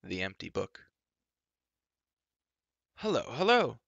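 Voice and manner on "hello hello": high, loud cheerful call. Acted. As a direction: hail the companions from afar. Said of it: "called Run".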